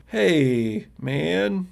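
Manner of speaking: wary, drawn-out greeting; very cautious. Acted. Youth greets a stranger on his doorstep, cautious. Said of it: "he's very cautious".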